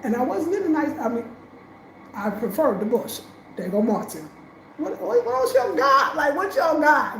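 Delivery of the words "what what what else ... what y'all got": higher, louder, daring them; brash challenge. Retold or imitated. Telling officials she can handle anything, brash challenge. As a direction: throw the question at them like a dare.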